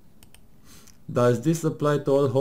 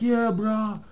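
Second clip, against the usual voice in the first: mock bro voice; 10 semitones higher than usual. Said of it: "the people are like".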